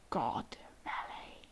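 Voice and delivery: slow, low whisper; hushed reveal. Acted. Reader revealing the country's name, slow dramatic whisper. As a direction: whisper the name slowly for suspense.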